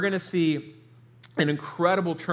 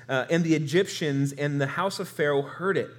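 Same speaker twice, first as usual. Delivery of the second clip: usual speaking voice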